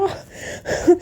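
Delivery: sharp high unvoiced "aah" of pain; breathy cry of hurt; pained gasp. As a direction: gasp as if hitting the floor. Falls, cries out.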